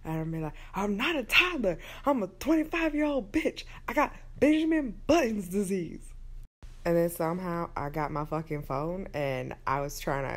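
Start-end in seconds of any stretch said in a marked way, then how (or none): 0.7-6.1 put-on high, loud toddler yell; shouting to be believed, high and loud; retold yelling. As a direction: yell it at strangers, pitched high. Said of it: "I just keep yelling".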